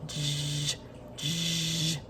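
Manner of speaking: j as a sustained low "zhhh" buzz; isolated letter sound. Shown as a demonstration. Teacher showing children the first sound of jacket, j held as a buzz. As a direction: hold the consonant j, softly, without naming the letter. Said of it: "What makes the j sound?".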